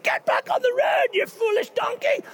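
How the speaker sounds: high, loud furious yell at the donkey; Balaam shouting at his donkey; angry shout. Retold or imitated. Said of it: "and Balaam's like".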